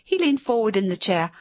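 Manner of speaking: plain reading voice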